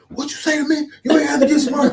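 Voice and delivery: high, loud mock outrage; patient shouting at the nurse, hammed-up indignation; angry patient. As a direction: fire it back like he's been insulted. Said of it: "He was like".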